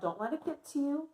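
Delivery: ordinary talk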